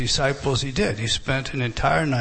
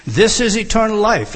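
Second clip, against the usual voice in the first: emphatic proclaiming; 7 semitones higher, 6 dB louder than usual. Acted. Preacher proclaims key verse.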